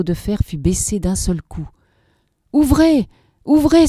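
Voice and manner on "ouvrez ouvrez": high, loud desperate begging; frantic shouted plea; loud pleading. A narrator's reading. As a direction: pound on the shutter and beg. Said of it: "supplia-t-il".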